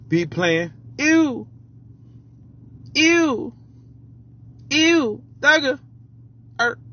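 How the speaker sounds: [name] impression. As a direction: yelp the ad-libs up high like a rapper. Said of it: "I'ma try to do my best thugger thugger".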